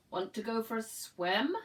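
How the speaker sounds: the duck calls to the spider, loud and cheerful; loud duck invitation. Acted. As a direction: call it over the pond.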